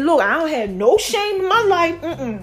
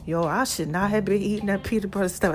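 out of breath; 6 semitones lower, 4 dB quieter than usual. Real, not acted. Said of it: "I'm out of breath with this whole asthma thing".

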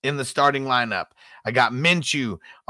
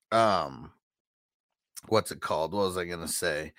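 stoner voice; 8 semitones lower, 7 dB quieter than usual. Pretend. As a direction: sound baked and spaced out. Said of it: "I'm so high right now".

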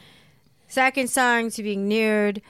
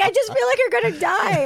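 mock worried assistant; 10 semitones higher than usual. Assistant voiced fretting over her.

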